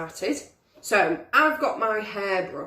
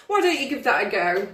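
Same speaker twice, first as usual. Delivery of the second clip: ordinary talk, nothing marked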